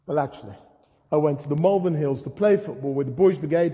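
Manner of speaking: normal talking voice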